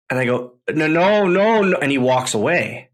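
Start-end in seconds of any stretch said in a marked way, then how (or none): 0.7-1.8 rising, louder no no no no; panicked refusal. Retold or imitated. To John Cena, refusing his offer in a flustered rush. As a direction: wave off the offer in disbelief.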